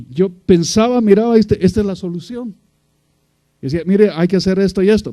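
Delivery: loud, high, impassioned sermon climb; preaching at full force. Acted. Preacher drives the story home to the congregation, loud and high. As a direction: build to a loud preaching peak.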